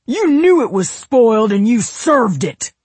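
loud, high furious scream; enraged, shouted accusation; next-level rage. A narrator's reading. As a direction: yell the accusation at full tilt. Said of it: "hitting his own next level".

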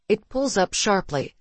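ordinary delivery

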